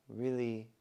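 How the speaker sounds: normal talking voice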